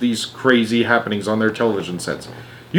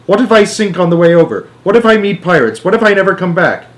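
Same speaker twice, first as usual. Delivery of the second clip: put-on worried boy; 7 semitones higher, 4 dB louder than usual. Charlie frets about the voyage.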